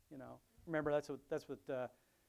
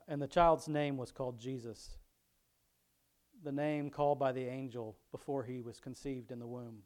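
louder, measured Bible reading voice; scripture reading; 9 dB louder than usual. Acted. Pastor reading Luke aloud to his congregation, louder reading voice. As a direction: read the passage out to the congregation.

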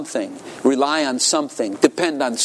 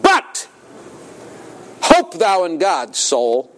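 King David's cry; 3 semitones higher, slower than usual. Retold or imitated. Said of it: "He said".

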